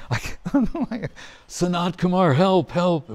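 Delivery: giggling through a helpless cry for help; laughing mock plea. A sage overwhelmed by Krishna's flute, laughing mock plea. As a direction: play the helpless sage, laughing as you beg.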